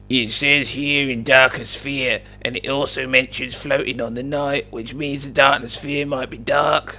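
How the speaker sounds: slow, slightly high character voice; Sir Pixel. Acted. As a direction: read it out slowly in a put-on voice. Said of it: "Sir Pixel exclaimed".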